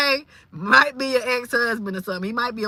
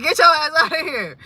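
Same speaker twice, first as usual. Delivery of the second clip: a louder, higher mock order; lightly voiced staff throwing out a patient; fed-up hospital staff; 5 semitones higher, 5 dB louder than usual. Hospital staff kicking out a screaming patient, fed up. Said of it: "they will be like".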